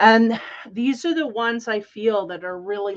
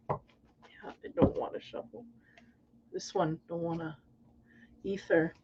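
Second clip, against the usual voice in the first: whispered, much softer, private; whispered to herself; 3 semitones lower, 10 dB quieter than usual. Real, not acted. Reader whispering to herself about shuffling the deck. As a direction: murmur it just for yourself. Muttering to self, shuffling cards.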